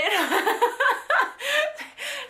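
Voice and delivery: a high, bursting laugh; loud laugh. Real, not acted. Laughing at own retold panic.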